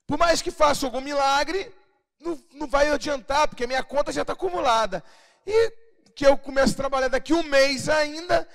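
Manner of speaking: loud, high, anxious doubting voice; a worried believer argues against the miracle; incredulous believer. Pretend. Worried believer doubting the prophecy aloud, loud and high. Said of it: "Aí ela começa a imaginar".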